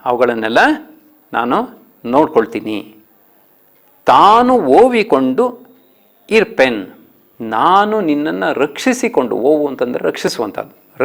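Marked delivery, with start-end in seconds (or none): 4.1-6.9 high, slow chanted verse; poem recitation. Shown as a demonstration. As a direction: sing out the opening, then settle. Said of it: "ಹದಿನಾರನೇ ಪದ್ಯ". (ಎತ್ತರದ ಧ್ವನಿಯಲ್ಲಿ ಪದ್ಯ ವಾಚನ)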